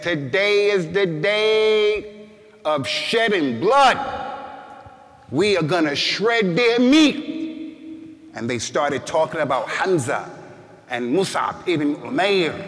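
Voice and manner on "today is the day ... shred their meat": high, slow, roaring war cry that peaks on blood; fierce battle cry. Retold or imitated. Companions thirsting for revenge at Mecca, fierce slow roar. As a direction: companions vowing to shed blood, roaring slowly.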